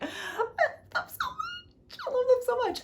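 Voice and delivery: high, squeaky fake-crying gush; tearful gushing. Reader swooning over the couple, tearful high-pitched gushing. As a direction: squeal it through a laughing sob.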